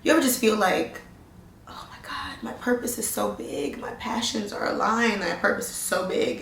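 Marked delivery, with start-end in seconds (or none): 1.7-6.4 awed whisper about a big purpose; breathless hushed awe. Real, not acted. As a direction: whisper it like a secret dream. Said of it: "It's scary".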